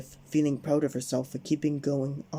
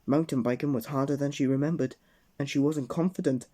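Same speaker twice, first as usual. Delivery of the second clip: usual speaking voice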